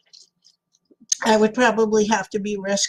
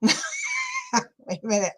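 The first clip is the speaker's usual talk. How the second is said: high laughing squeak; 4 dB quieter, much slower than usual. Real, not acted. Laughing squeak while reading chat.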